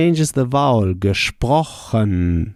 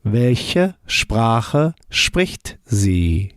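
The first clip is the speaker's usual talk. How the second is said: very slow "Welche Sprache spricht sie?"; learner-paced German; slow model answer; much slower than usual. Shown as a demonstration. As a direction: give each word room. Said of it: "How would you say".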